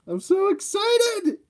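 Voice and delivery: high, slow squeal of excitement; excited. Real, not acted. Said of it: "Oh, I'm so excited".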